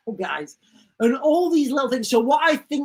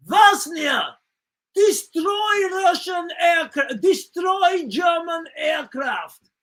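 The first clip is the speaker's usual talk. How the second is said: higher, slow hammed-up command shout; mock Russian command; 7 semitones higher, slower than usual. Sailor orders Vosnia to destroy aircraft, slow mock shout. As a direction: bark the wrong order. Acted garbled order, Chinese whispers.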